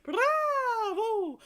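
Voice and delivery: very high, loud, ringing bravo; soaring bravo. Shown as a demonstration. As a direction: call it from the top of the voice. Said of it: "See how high you can go".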